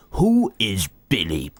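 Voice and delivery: put-on slow, puzzled old dad; lightly voiced confused father; imitating dad. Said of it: "he kept on saying".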